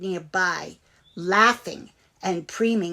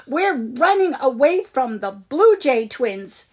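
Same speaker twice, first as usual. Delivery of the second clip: urgent warning; 5 semitones higher, 6 dB louder than usual. Acted. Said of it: "Willy Billy replied".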